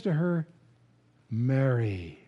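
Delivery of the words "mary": low, tender "Mary"; gently calling her name. Retold or imitated. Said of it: "in Aramaic".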